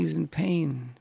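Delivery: normal talking voice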